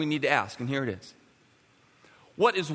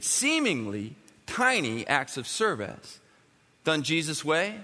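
ordinary talk

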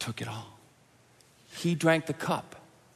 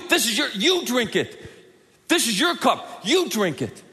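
accusing; 9 semitones higher, 6 dB louder than usual. Pretend. Jesus blaming us, hypothetically.

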